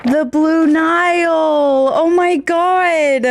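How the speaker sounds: high, slow, breathless delight at the gift; real thrilled surprise. Real, not acted. Fan receiving a signed poster, overjoyed. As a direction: stretch it out, overjoyed and stunned.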